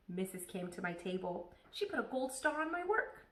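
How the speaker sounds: ordinary delivery, nothing marked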